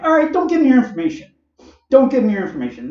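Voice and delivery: high, fast mock-indifference; sarcastic. Son mocking his dad over the coupons, sarcastic. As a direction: toss it off sarcastically, up high and quick. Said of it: "Don't be a smart ass".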